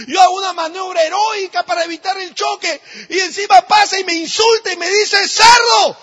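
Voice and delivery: high, loud, indignant shouting; furious rant. Acted. Insulted driver raging about the woman who swerved, furious shouting. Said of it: "se llenó de rabia y dijo".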